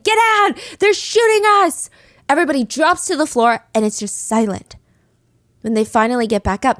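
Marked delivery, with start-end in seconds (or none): 0.0-1.9 high, loud panicked scream. Acted. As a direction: yell a frantic warning to the whole room. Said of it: "he starts screaming".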